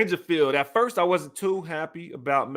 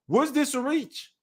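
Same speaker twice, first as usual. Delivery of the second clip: high questioning; 8 semitones higher, slower than usual. Real, not acted. Reading a pick, asking chat.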